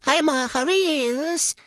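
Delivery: sing-song greeting, then aged-voice filter; old-person voice effect. Shown as a demonstration. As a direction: voice changed to sound elderly. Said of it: "napapabagong matanda ang aking boses".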